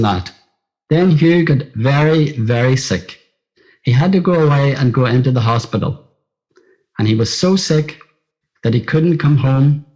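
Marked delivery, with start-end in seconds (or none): none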